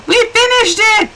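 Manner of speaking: high, loud burst of triumph; excited celebration. Real, not acted. Player finishing the fence at last, excited celebration. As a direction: exclaim it, thrilled at the finish.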